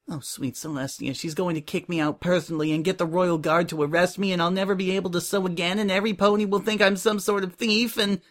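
spiral into panic without stopping for breath; panicked spiral. A narrator's reading. Coco dreading that Prim will kick her out, panicking. Coco's panicked thoughts awaiting a tirade.

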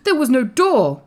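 high, loud insistence; astonished cry. A narrator's reading. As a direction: protest in disbelief, voice up. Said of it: "cried Colin".

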